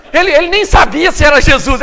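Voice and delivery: usual speaking voice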